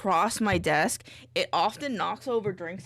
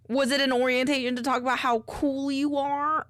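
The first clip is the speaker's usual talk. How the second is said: higher-pitched goofball teasing, laughing after; goofy teasing; 5 semitones higher than usual. Pretend. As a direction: tease like a goober. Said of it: "being a bit of a goober".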